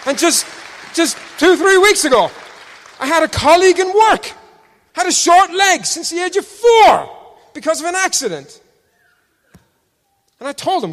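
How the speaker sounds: loud, high testimony; full-force preaching. Real, not acted. Preacher testifying to healings, loud and high. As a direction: proclaim it with rising fire.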